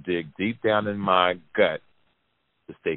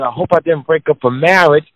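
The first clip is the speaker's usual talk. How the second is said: higher, louder anxious daughter; much higher, louder fretful girl; worried girl's voice; 8 semitones higher, 10 dB louder than usual. Retold or imitated. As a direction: say it like she fears what she's done. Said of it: "She said".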